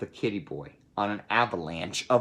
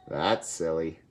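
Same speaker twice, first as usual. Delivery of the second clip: usual speaking voice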